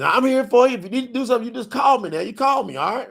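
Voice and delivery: a lightly hammed-up dad promising to be there; daddy's voice.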